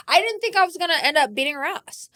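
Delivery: ordinary talk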